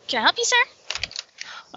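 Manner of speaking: high, slow, sweet helpful voice; Elle's sweet voice. Acted. Elle sweetly offering help to the clerk. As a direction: lilt it up sweetly. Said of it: "Elle goes over".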